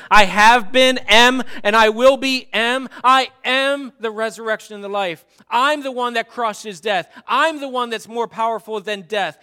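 high, loud preaching proclamation; full-force preaching. Acted. Preacher proclaiming to the congregation, loud full-force preaching. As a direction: thunder it out from the pulpit.

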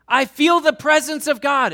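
high, loud proclamation; hammed-up ecstatic shout; mock elation. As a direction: cry it out as if on a spiritual high. Acted spiritual high declaration.

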